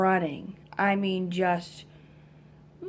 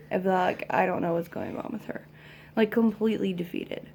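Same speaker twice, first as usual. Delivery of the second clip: ordinary delivery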